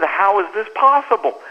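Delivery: ordinary talk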